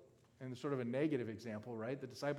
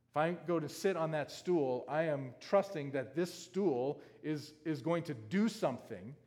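louder, higher, driving sermon voice; preaching at full force; 4 semitones higher, 8 dB louder than usual. Acted. Pastor driving home the stool illustration, full-force preaching. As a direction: press the point home to the whole room.

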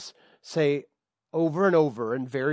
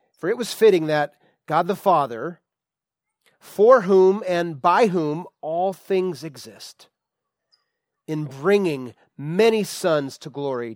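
slow, louder formal scripture read; 3 semitones higher, 6 dB louder, slower than usual. Acted. Preacher reading the verse aloud to the church, solemn and emphatic. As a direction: lift the verse out for the congregation.

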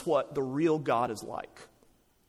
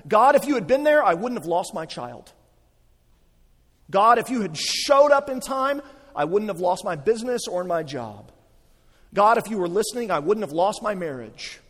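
louder, higher, pained cries of why; anguished complaint to God; 4 semitones higher, 8 dB louder than usual. Retold or imitated. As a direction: voice a grieving person's accusations at God. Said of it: "That might be a question you're asking".

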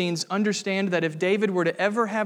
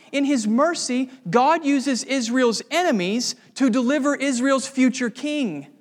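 preaching; 4 semitones higher than usual. Acted. Pastor urging friends to see God's mercy, fervent. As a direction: lift the congregation to marvel.

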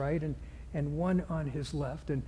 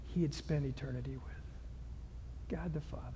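softer, lower breathy hush; whisper; 5 semitones lower, 13 dB quieter than usual. Real, not acted. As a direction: drop to an intimate whisper. Hushed climax of sermon.

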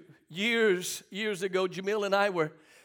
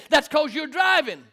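retold retort; 6 semitones higher, 5 dB louder than usual. Acted retort to wife.

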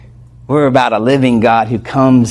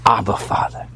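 soft prayerful cry; 5 semitones lower, 6 dB quieter than usual. Pretend.

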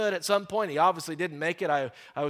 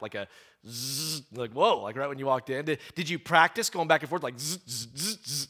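buzzing zzz like an electric zap; mock zap buzzing; imitated zap buzz; 5 semitones lower than usual. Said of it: "I make jokes often like this".

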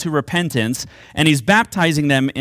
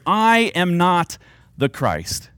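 slow emphatic confession; 2 semitones higher, much slower than usual. Shown as a demonstration. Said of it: "This five-word confession".

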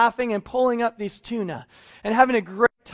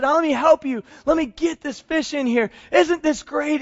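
high, bright cheer while hauling fish; a happy, hearty Jesus; joyful; 8 semitones higher, 3 dB louder than usual. Acted. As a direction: share the delight of the catch.

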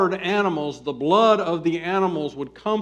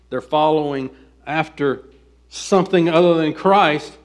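normal talking voice

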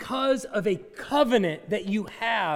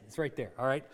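usual speaking voice

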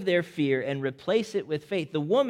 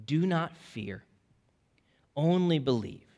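comforting; 4 dB quieter than usual. Acted. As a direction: reassure a frightened father gently. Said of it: "He speaks words of truth and comfort".

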